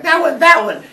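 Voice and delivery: high, loud, hurried finger-pointing; blaming kids. Acted. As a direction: point at your brother to dodge blame.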